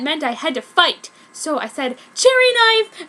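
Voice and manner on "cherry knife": high, loud spell call; battle call. A narrator's reading. Heroine summons a knife to fight Pai, shouting. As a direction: shout the weapon's name.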